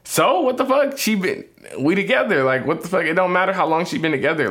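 high-pitched defensive argument, easing slightly; heated objection. Real, not acted. Man defending his relationship to his co-parent, heated. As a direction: come in high and keep arguing. Argues relationship length is irrelevant.

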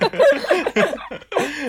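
high burst of laughter at his own idea. Real, not acted. Cohost laughing at his own wild joke, high laugh.

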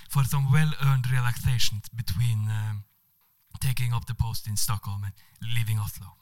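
charming Spanish man with a put-on accent. Spanish diplomat charming the woman on the train, Spanish accent. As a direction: play the suave Spanish diplomat. Manuel continues.

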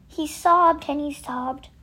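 plain reading voice

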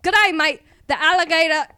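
high, loud hammed-up Australian accent. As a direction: attempt a broad Aussie greeting. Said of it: "you have to start with".

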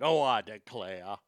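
low, slow mock-Southern drawl; mock the Southern phrase low and drawn out; fake Southern accent. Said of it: "Oh, I hate that shit".

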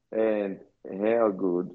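usual speaking voice

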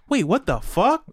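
put-on high-pitched, astonished kid reaction; amazed realization. Host voices Italian kids connecting the dots, amazed. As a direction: act out a sudden stunned discovery. Acts out kids' sudden realization.

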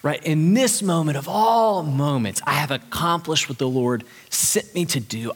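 normal talking voice

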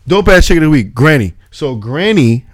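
loud, high hype shout-out; excited shout-out. Real, not acted. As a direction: hype her up loudly.

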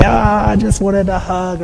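spring the hug on them with a happy burst; playful cry. Retold or imitated. Dad grabbing his daughters for a hug, playfully exclaiming. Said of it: "I'd grab them and say".

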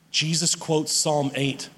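usual speaking voice

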